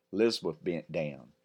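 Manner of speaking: usual speaking voice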